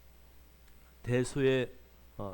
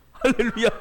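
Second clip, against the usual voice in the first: laughing hallelujah; 3 semitones higher, 5 dB louder than usual. Real, not acted. Joke about his own looks.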